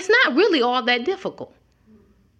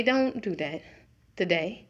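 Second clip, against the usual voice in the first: hushed, soft, disappointed warning; barely above a murmur, just a nudge; gentle tap; 4 semitones lower, 7 dB quieter than usual. Pretend. God quietly nudging a believer: soft hushed warning. God taps you to stop.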